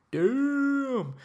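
high, drawn-out reaction; long high-pitched marvel; mock amazement. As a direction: stretch it out high in disbelief. Acted reaction in retold chat.

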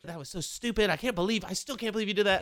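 high mock scolding; imitating scolding partner. Imitating partner scolding her at dinner, high mock scolding. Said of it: "he is like".

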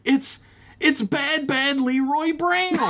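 high, slow frightened gasp; gasping girl. Acted. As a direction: gasp the name out, scared. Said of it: "Sherry gasped".

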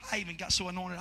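ordinary delivery

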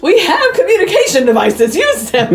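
high, exasperated retort; imitated fed-up crewmate; mock exasperation. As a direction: plead for common sense, half laughing. Exasperated retold complaint.

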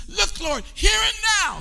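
loud, high, joyful outburst; excited shout. Acted. Zacchaeus shouts to Jesus in excited joy. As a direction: shout it like the moment of your life. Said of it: "he says".

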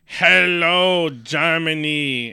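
loud, drawn-out mock hail; mock big greeting. Retold KGB agent greeting a spy, mock big greeting. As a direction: boom it out, stretching the country's name.

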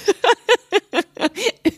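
sudden high burst of laughter; laughing. Real, not acted. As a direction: crack up helplessly mid-sentence. Laughs while recalling the upset.

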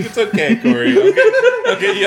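put-on high, loud cooing baby talk; cooing at brain-creature Corey. [name] cooing at shrunken Corey. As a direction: coo at him like a pet. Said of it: "be like".

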